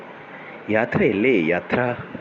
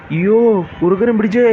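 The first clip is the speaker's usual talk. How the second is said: higher, louder scream of alarm; panicked yell; 9 semitones higher, 5 dB louder than usual. Acted. A chased animal yells as the fox grabs at him. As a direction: shriek as you run. Fleeing, crying for help.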